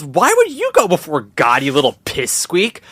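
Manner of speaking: Lauren Ward's friend scorning Billy, slow contempt; disdainful heavenly friend. Retold or imitated. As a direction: sneer it down at him slowly. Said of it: "She goes".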